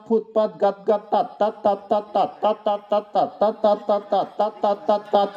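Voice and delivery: evenly beaten, percussive syllables; staccato repetition. Acted. Mad poet being slapped in the satire, staccato chant. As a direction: hammer each short word like a repeated slap. Poem imitates repeated slaps.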